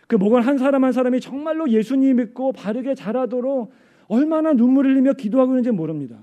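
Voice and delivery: high, loud pulpit appeal; impassioned preaching. Real, not acted. Preacher honouring leaders who pray in tears, fervent.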